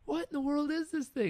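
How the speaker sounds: half-laughing mock outrage at the painting; a retold friend scoffing at a forty million dollar orange square, incredulous. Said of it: "and he's like".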